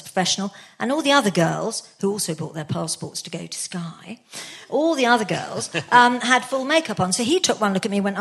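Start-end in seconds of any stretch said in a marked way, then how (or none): 2.0-4.2 dropped to a whisper for a quick aside; a hushed little aside. Real, not acted. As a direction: whisper it as a throwaway side note. Quick aside mid-story.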